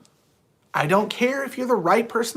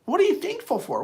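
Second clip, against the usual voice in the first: ordinary talk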